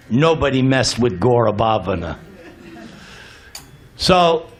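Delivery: usual speaking voice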